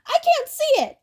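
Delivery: very high, loud crone panic; shrieking witches; hag yell. Acted. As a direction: yell blindly for the eye. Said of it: "all three sisters yelled".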